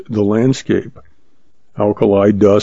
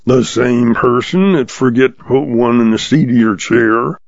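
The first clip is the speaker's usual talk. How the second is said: usual speaking voice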